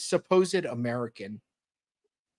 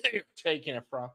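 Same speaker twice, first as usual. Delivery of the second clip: ordinary delivery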